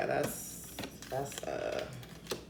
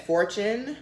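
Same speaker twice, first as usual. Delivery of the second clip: normal talking voice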